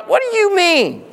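ordinary talk, nothing marked

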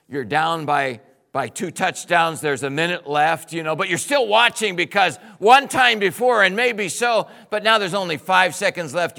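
loud, driving sermon voice; stirred-up preacher building the story; fiery preaching. Acted. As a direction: preach it out to the back row. Preaching at full force.

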